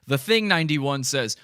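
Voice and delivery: normal talking voice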